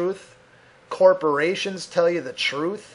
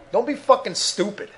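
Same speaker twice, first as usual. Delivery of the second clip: normal talking voice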